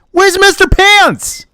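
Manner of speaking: very high squeaky mock outrage. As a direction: squeak out "Where's Mister Pants?". Squeakier repeat.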